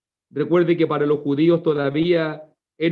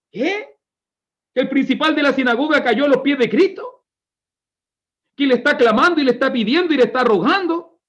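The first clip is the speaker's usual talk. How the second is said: high-pitched, incredulous string of questions; astonished crowd, pitch jumping up; shocked onlookers; 7 semitones higher than usual. Acted. Onlookers seeing the synagogue leader fall at Christ's feet, incredulous.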